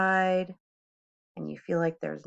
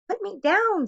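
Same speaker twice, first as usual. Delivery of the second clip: very high, loud squealing fish; a fish begging to be put down; frantic fish high in the air; panicked fish; 13 semitones higher, 4 dB louder than usual. Acted. Fish cries out held up.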